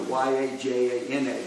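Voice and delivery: ordinary delivery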